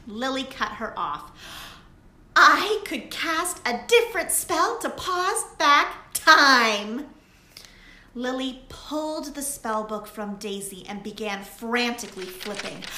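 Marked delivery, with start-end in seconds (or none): 2.4-7.1 higher and much louder, bursting out; excited idea. A narrator's reading. Lily interrupting Daisy with her plan, excited. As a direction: blurt out the new plan.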